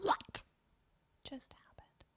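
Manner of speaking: hushed, breathless what-just-happened; stunned whisper. Real, not acted. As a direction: whisper it in disbelief. Startled by something on stream.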